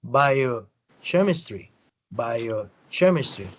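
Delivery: biochemistry said slowly, each syllable clear; slow modelled word. Shown as a demonstration. Teacher modelling biochemistry slowly for a student. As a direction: drag out biochemistry for the learner. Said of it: "lo mismo es con bioquímica".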